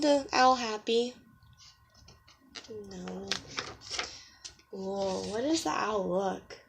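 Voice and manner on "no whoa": ordinary delivery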